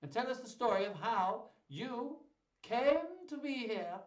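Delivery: resume the grand duke's hearty command; booming duke. A narrator's reading. Duke Senior voiced, resumed.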